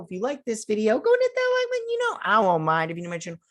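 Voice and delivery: closing plea to the viewers, upbeat outro; upbeat youtuber outro. Acted. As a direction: sell the like and subscribe. Channel sign-off outro.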